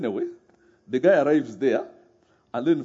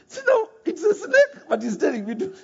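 laughing disbelief. Retold or imitated. Confused man retold, laughing.

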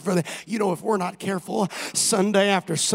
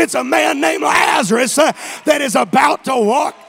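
louder, rising preacher; building preaching; 7 semitones higher, 6 dB louder than usual. Acted. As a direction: build it up toward a shout.

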